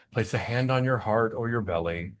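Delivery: usual speaking voice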